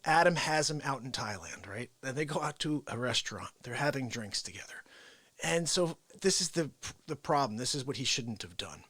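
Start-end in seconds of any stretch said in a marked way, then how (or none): none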